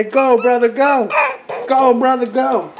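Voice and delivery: high, bright cheer for the brother. Acted. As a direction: cheer him on like a fan. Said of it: "cheer him on. Say".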